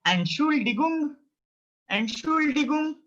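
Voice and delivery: Entschuldigung on a high, level pitch, "en-shool-dee-goong"; Entschuldigung, high and evenly sounded out; pronunciation model. Shown as a demonstration. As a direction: chant Entschuldigung for learners to copy. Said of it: "try to pronounce it".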